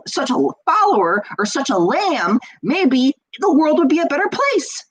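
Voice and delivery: high, pointed sarcastic monologue; exasperated mock rant. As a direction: act out someone thinking it over, rising to a rant. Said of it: "go off on that little rant".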